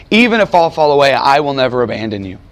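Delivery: usual speaking voice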